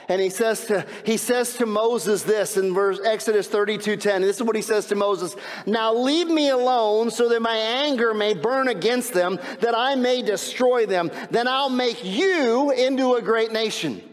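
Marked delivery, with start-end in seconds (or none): 5.6-14.0 raised, sustained preaching pitch; forceful scripture reading. Acted. Preacher reading God's words to Moses, forceful declaiming.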